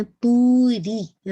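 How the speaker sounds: توری held long with a sharp kasra on را; stretched vowel. Shown as a demonstration. Teacher demonstrating the long vowel in توری. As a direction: draw out توری slowly, crisp on the را. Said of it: "را کی کسر sharp رکھیے گا".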